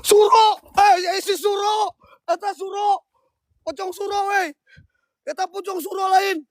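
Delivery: high, startled shouts of the name; yelling out as the ghost appears; alarmed calling out. Real, not acted. Ghost hunter yells at the pocong Suro, high and startled.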